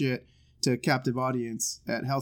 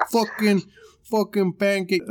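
higher, louder mock movie line; hammed-up imitation, pitched up; mimicking the clip; 6 semitones higher, 5 dB louder, slower than usual. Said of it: "different ways we can say".

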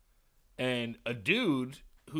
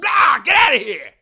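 explosive high, loud shout; acted scare-off yell; 9 semitones higher, 17 dB louder than usual. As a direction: bellow to frighten someone away. Repeats the store man's scare-off yell.

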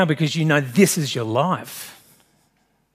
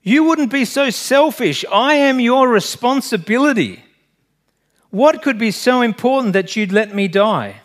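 high, raised voice of desperate protest; shrieked plea; 7 semitones higher, 4 dB louder than usual. A narrator's reading. Stranger begging the man not to let him die, shrieking. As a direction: plead high and loud, pressing each phrase. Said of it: "the stranger shrieked".

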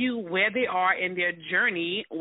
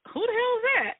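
disbelieving, pitched up high; incredulous high exclaim; 13 semitones higher, faster than usual. Retold or imitated. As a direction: squeal it in disbelief. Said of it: "I'm looking at the phone like".

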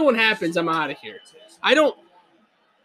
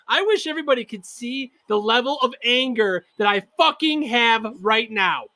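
ordinary talk, nothing marked

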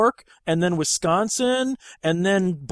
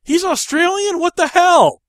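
much higher, louder mock shock; surprised disbelief; 12 semitones higher, 7 dB louder than usual. Hearing Guy Pearce's real accent, high incredulous shock. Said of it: "I'm like".

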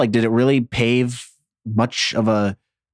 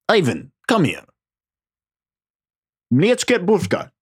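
cartoon Russian summons; terrible fake Russian accent; 7 semitones higher than usual. Soviet boss calling Ivan over, fake Russian accent. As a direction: call out in a bad Russian accent. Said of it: "That's a terrible fake Russian accent".

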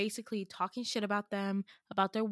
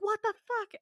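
a much higher shocked cry; shocked outburst; 12 semitones higher than usual. Retold or imitated. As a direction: react to the sudden killing in shock. Friend killed by spider.

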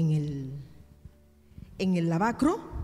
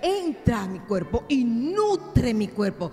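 loud, driving sermon voice; full-force pulpit delivery; fiery preaching; 3 semitones higher, 3 dB louder than usual. Acted. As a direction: preach it hard to the congregation.

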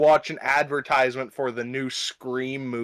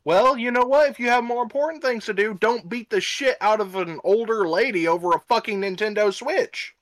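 higher-pitched mock telling-off; indignant scolding; 7 semitones higher than usual. Host tells off the absent attacker over the Switch, indignant. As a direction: scold him as if he were here, pitch raised.

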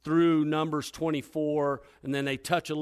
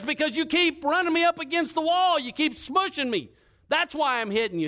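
arguing back; 12 semitones higher, 4 dB louder than usual. Acted. Balaam argues back with donkey.

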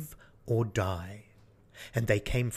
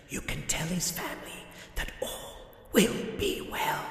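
Death; 3 semitones higher, 3 dB quieter than usual. Acted. Death voiced, long speech.

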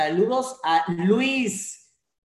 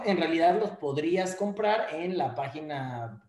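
usual speaking voice

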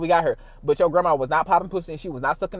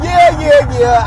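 giddy yeah; 7 semitones higher, 11 dB louder, much slower than usual. Real, not acted. Laughing at her own joke.

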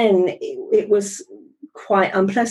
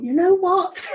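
much higher, re-enacted moment of deciding; fed-up resolve; 11 semitones higher than usual. Thinking back on the coffee machine meeting, fed up. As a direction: voice jumps up as if reaching a decision. Acting out her decision to quit.

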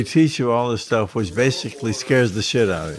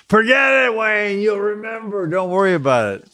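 high-pitched mock holler, easing down toward the end; brushing off worries; 9 semitones higher than usual. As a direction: call out high over his fretting, imitating the instructor. Jump master cuts off his reciting.

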